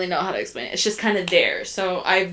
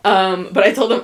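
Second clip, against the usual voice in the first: usual speaking voice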